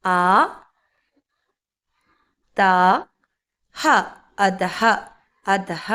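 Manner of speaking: ആ, ത, ഹ apart, then joined low as അതഹ; syllable-by-syllable pronunciation. Shown as a demonstration. Teacher sounding out a word for learners, അതഹ syllable by syllable. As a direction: spell out "a, ta, ha", then blend into അതഹ. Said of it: "അപ്പം എങ്ങനെ ഉച്ചരിക്കേണ്ടത്?".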